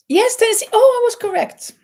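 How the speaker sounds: high, loud burst of glee; delighted. Real, not acted. Host thrilled her guess about the player was right, high and loud.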